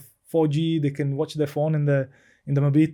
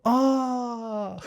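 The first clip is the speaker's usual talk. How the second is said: long, high "aah" as the penny drops; imitated realization "aah"; 9 semitones higher than usual. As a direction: mimic someone finally understanding, drawn out and high. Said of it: "I heard him just go".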